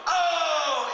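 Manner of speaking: high, loud crowd oh; crowd ooh. Retold or imitated. The audience reacting to the roast, loud high oh. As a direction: whoop like a stunned crowd. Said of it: "two hundred people going".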